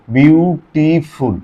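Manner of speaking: Beautiful pulled apart slowly into syllables; syllable demo. Shown as a demonstration. Teacher proves beautiful has several syllables, slow. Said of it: "একবারে উচ্চারণ হয়েছে? না".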